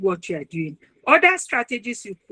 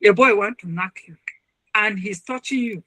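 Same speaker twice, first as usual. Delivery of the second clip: usual speaking voice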